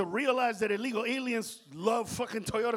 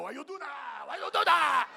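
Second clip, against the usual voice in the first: mock shouted "Why you do that?"; mock shouted question; 6 semitones higher than usual. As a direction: holler it like an outraged crowd. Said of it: "they were like".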